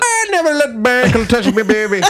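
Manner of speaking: high, silly Irish caricature; hammed-up Irish wail; mock Irish accent. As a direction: pile on a high-pitched fake Irish accent. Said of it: "Why is she Irish?".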